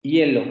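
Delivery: HIELO begun on a plain vowel I, "ee-elo"; pronounced with a vowel I. Shown as a demonstration. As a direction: say HIELO with a clear I at the start, no Y. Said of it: "se pronuncia así".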